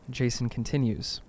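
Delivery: ordinary delivery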